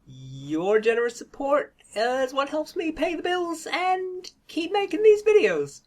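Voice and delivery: high, slow syrupy gratitude; mock-sweet patron thanks, high and slow; sappy thank-you voice. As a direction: lay the thanks on thick.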